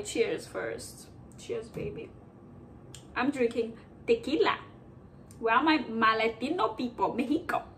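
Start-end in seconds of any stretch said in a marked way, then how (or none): 0.0-2.1 whispered toast. Real, not acted. By the way, cheers first.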